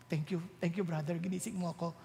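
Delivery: meek gratitude. Retold or imitated. Sleeper now grateful when woken.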